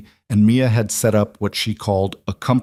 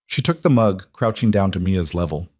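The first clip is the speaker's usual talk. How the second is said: plain reading voice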